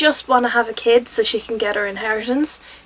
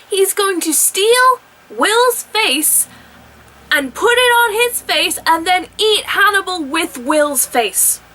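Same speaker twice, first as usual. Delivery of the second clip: incredulous; 7 semitones higher, 3 dB louder, slower than usual. Real, not acted. Spells out the absurd plan.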